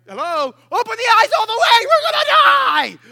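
very high, loud hysterical scream; hammed-up panicked yelling; freaking out. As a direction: scream in mock terror, stretching the end. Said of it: "they're freaking out".

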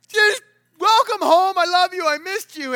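high, loud, slow heartfelt welcome; loud loving welcome. Retold or imitated. Dad embracing his returning son, loud and loving. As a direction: pour out the welcome.

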